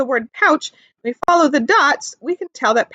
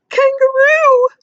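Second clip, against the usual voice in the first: much higher, louder and slow kangaroo voice; other kangaroo voice; 13 semitones higher, 5 dB louder, slower than usual. Acted. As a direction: say it like a shy young kangaroo. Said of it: "this person said".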